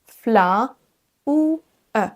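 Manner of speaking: fla, ou, e broken apart; piece-by-piece fla ou e; three separate sounds. Shown as a demonstration. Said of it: "trois sons qui se suivent".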